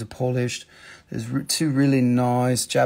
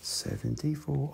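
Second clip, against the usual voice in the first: "Seventy-four" much softer, flat and falling; quiet repeat; 11 dB quieter than usual. Shown as a demonstration. Seller repeats the price to viewers, quiet and falling. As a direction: drop "Seventy-four" to a soft aside. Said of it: "price said twice".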